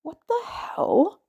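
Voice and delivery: high, slow startled chirp; high surprised chirp. A narrator's reading. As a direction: squeak it out like a startled spider kid. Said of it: "Then the kid chirped".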